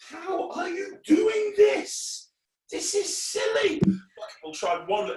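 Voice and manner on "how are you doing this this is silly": high, slow wail of disbelief; hammed-up helpless protest; mock exasperation. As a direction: squeal it like a baffled clown. Feigning exasperation at the trick.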